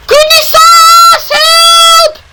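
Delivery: desperate cry for help. Acted. Engines crying for help as fire spreads, desperate shout. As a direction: scream for help in panic. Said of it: "they cried".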